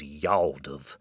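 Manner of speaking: yawldive said slow and low as one squeezed word, jawl-dive; yawldive, Texan drawl. Shown as a demonstration. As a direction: savour yawldive, drawn out low. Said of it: "admire the muscular nature of that contraction".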